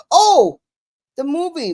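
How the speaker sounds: sharp high loud oh; sudden remembering. Real, not acted. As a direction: blurt it as it comes to you.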